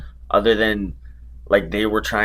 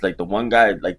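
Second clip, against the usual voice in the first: ordinary delivery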